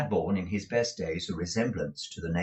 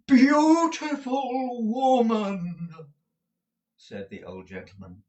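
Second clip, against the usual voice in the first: put-on florid, theatrical old suitor, high and slow; old gentleman; 6 semitones higher, 4 dB louder, slower than usual. A narrator's reading. Dotty old gentleman courting Mrs Nickleby over the wall, grand and slow. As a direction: woo her grandly like a dotty old gallant.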